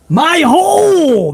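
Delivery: mock wail, high and drawn out; Nia Jax. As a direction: cry it out high and slow. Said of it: "the last thing we heard of, uh, Nia Jax".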